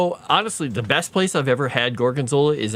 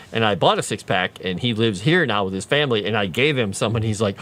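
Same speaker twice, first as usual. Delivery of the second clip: ordinary talk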